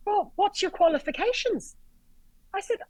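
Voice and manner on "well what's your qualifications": high, mock-indignant challenge; indignant doctor. Doctor challenging an off-duty medic, mock indignant. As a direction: imitate a put-out doctor, pitched well up.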